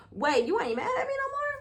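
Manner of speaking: high, incredulous question; mock disbelief. Asking the man if his anger is gone, high-pitched surprise. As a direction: climb high in puzzled disbelief.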